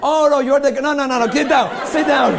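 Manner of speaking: loud, laughing mock alarm, no no no; hammed-up fright, backing away laughing; mock panic. Magician pretending to flee the goblet-breaking volunteer, mock panic.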